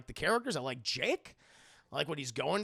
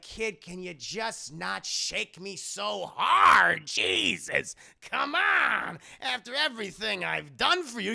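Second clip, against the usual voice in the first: slow loud gruff doll complaint; Chucky voice; 4 semitones higher, 6 dB louder, slower than usual. Retold or imitated. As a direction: grumble loudly at the kid. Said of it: "And he's like".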